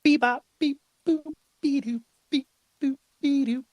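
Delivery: very high monotone robot beeps. Pretend. Speaker jokes about sounding like a robot, robot beeping. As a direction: beep and boop like a little robot. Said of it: "Do I sound like a robot".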